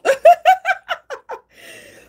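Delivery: a loud, high burst of laughter; high shrieking laugh; big laugh. Real, not acted. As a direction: crack up at yourself. Laughs off her crying.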